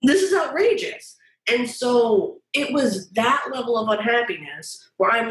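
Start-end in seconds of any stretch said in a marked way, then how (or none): 0.0-1.2 exasperated. Retold or imitated. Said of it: "being like".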